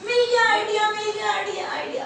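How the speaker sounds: high, excited eureka; delighted discovery. Acted. Rabbit suddenly finds a clever plan, excited. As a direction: burst out high, then let it settle.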